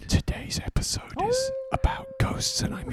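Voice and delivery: spooky whisper; breathy ghost-story whisper. Acted. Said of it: "spooky intro whisper".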